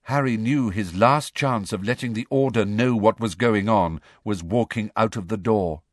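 usual speaking voice

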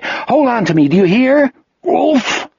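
high, desperate calling; urgent plea. A narrator's reading. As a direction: urge him to hang on, rising to a cry. Jack desperately urging fainting Wolf.

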